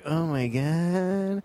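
drawn-out mock alarm; imitated girl. Girls reacting to his accent, mock worried. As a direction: stretch it slowly like a worried girl.

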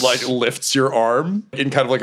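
normal talking voice

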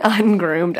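ordinary talk, nothing marked

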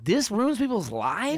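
high, drawn-out mock disbelief; incredulous disbelief. Comic recalling his cocaine letdown, incredulous.

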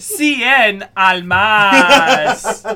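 very slow, loud, laughing Spanish "Cien Almas"; stretched Spanish accent. Retold or imitated. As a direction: drag out each syllable in a Spanish accent, laughing. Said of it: "I love that you do it in a Spanish accent".

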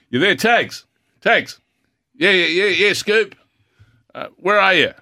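high, loud calls over a noisy room; calling out. Retold or imitated. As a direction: shout down the phone in a crowd. Retold phone call in crowd.

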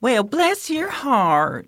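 slow, high Southern belle; Southern accent. Pretend. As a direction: drawl it sweetly like a Southern lady. Southern ghost phrase.

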